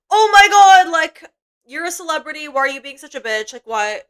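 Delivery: mock shriek at the start, high and loud, then settling; hammed-up outraged fangirl voice; mock outraged fan. Streamer imitating fans yelling at [name]'s security guard, mock outrage.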